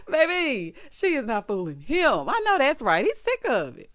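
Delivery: loud, high-pitched exclaiming; amused outburst. Real, not acted. Recapper delighted that he isn't being fooled, loud amused outburst. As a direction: crow it out loud and high after a big laugh.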